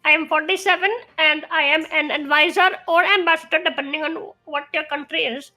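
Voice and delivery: put-on Indian accent, playing a fake diplomat; mock Indian accent. Cohost voicing the fake ambassador in a mock Indian accent. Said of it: "You do it so much better than I do".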